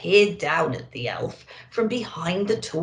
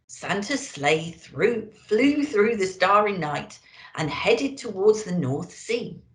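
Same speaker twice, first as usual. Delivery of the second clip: ordinary delivery, nothing marked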